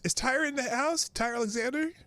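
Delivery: high, eager call into the room. Real, not acted. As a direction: hail him like a host. Calling out for a viewer.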